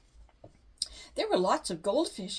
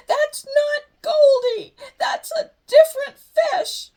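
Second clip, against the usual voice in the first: high, loud, slow tearful wail; crying out; 16 dB louder, slower than usual. A narrator's reading. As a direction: wail it out in shock and grief. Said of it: "Sister cried".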